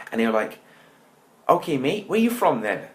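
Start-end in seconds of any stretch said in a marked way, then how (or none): 1.5-2.9 high-pitched mock Bristol accent. Cool kids questioning the newcomer, mock Bristol accent. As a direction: put on a farmer-like Bristol accent. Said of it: "they had a Bristol accent".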